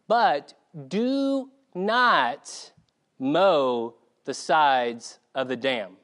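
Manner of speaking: slow, drawn-out warning on "do not"; stern warning. Retold or imitated. Parents warning the cousin, slow, stressed "do not". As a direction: lean hard on "do not", slowly. Parents warn against mowing dam.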